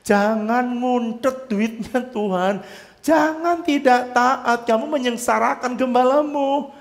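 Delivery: slow, high, emphatic warning; stern warning. Retold or imitated. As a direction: drag each word out as a solemn admonition. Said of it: "saya mengajarkan mereka".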